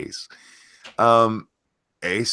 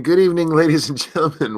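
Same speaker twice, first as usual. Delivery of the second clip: big, louder showman welcome; announcer voice; 8 semitones higher, 5 dB louder than usual. Shown as a demonstration. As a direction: open the show like a host. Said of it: "Let's try that again".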